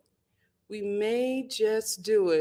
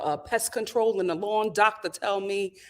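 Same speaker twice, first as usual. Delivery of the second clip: ordinary delivery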